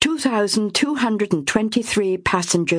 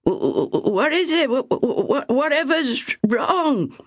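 just woken and confused, stumble over it; bleary-eyed stammer; 9 semitones higher than usual. Acted. Ethel roused from sleep, groggy stammering question. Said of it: "a bleary-eyed Ethel asked".

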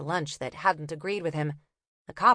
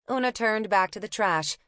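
ordinary delivery, nothing marked

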